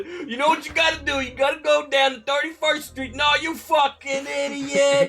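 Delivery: hammed-up New York shouting match; screaming argument. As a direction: yell high and loud like squabbling construction workers. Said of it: "They all just start screaming".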